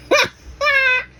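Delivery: short high burst of laughter; high loud cackle. Real, not acted. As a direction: cackle loud at your own threat. Laughs at her own threat.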